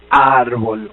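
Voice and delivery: "árbol" low and drawn out, R then B; demonstrated consonant cluster. Shown as a demonstration. Teacher showing two joined consonants, slow low "árbol". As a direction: say "árbol" slowly and low to show R meeting B. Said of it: "La R y la B larga son dos consonantes".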